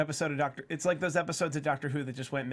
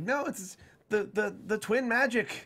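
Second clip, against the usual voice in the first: retold screaming; 7 semitones higher, 3 dB louder than usual. Fans at the show recalling their mock yell. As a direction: call out the twin magic half in jest. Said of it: "us screaming where we were just like".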